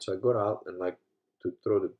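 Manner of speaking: usual speaking voice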